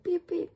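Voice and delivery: high, loud mock horn beeps; imitated car horn. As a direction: honk like a car. Car and bus honking.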